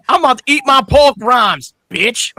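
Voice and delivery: very high, loud, slow sassy nurse voice; sassy nurse quote. Retold or imitated. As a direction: drag it out with full attitude. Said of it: "To quote from the movie Scary Movie six".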